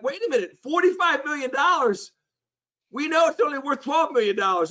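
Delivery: high, disbelieving protest; can't believe the price they're asking; incredulous. Retold or imitated. To the French seller, indignant high disbelief. Shocked at forty-five million price.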